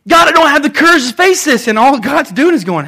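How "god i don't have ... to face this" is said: higher, louder anxious pleading to God; worried believer pleading with God. Acted. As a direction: fret aloud like a worried person praying. Voicing believer's prayer.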